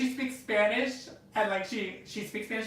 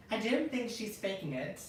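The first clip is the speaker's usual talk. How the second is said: slow, soft put-on British accent; 6 dB quieter, slower than usual. Shown as a demonstration. Reading a comment to show off, British accent. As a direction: read it slowly and softly in British. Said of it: "read Maddie Ben's comment in a British accent".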